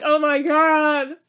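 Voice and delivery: real fan excitement; excited exclamation. Real, not acted. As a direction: burst out with glee. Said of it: "reacting to the episode".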